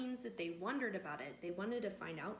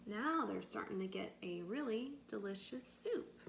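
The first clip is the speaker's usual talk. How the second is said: ordinary delivery, nothing marked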